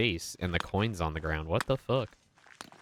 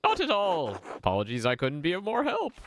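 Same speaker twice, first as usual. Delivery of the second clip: put-on much higher, prim and polite old tradesman; a sharply higher, precise voice for a fussy craftsman; 11 semitones higher than usual. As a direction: play the busy, fastidious master brewer. Voices Baltus character.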